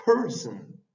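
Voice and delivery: "person" with no h breath, PER-son; model word. Shown as a demonstration. Teacher showing the h is not written, "person". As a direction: clip "person" with a quick stress on PER. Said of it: "pero es person".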